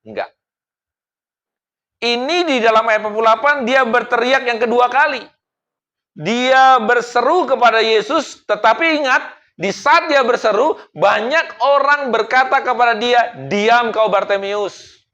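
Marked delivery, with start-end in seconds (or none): 3.0-15.0 loud, slow, high sermon build; fiery preaching. Acted. Preacher pleading with the congregation, fiery.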